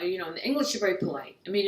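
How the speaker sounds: ordinary talk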